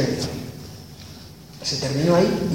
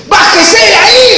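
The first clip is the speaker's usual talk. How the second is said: high, loud barked order; cop's shout; 12 semitones higher, 4 dB louder than usual. Retold or imitated. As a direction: shout it down like an angry cop. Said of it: "le decía el cana".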